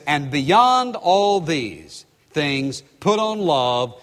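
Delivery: very slow, weighty delivery; solemn, drawn-out apostolic reading; slow emphasis. Acted. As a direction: lay each word down slowly. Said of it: "this is the way he says that".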